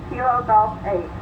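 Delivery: usual speaking voice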